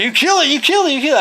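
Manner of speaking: high-pitched imitation of excited kids; mock clamoring children, voice way up; accusing kids. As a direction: chant the accusation in a high kid voice. Retold kids accusing him.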